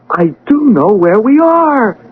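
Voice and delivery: rising wonder and delight; thrilled recognition; growing excitement. Acted. Patient recognizing the hospital, rising excitement.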